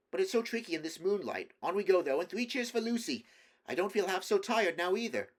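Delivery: high, loud, rallying voice; rally the party with fresh energy; spirited cheering on. A narrator's reading. Peter leads on and cheers for Lucy, excitedly.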